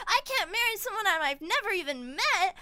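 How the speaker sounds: high-pitched mock outrage; hammed-up reluctant prince; indignant protest. Prince protests arranged marriage, acted.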